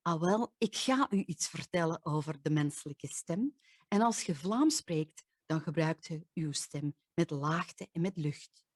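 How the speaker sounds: Flemish Dutch with low pitch and lots of air; Flemish accent. Shown as a demonstration. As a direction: speak it like a Belgian from Flanders. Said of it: "They sound like this".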